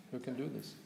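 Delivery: hushed rhetorical echo; quiet repeat. Acted. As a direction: let the question sink in softly. Repeated rhetorical challenge to audience.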